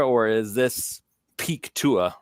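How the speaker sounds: ordinary talk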